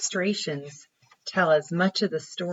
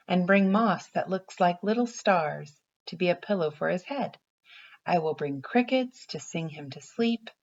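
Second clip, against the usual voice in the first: gentle storybook read-aloud; reading to children; 3 semitones higher than usual. Acted. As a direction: read it like a picture book for little kids. Teacher reads picture book aloud.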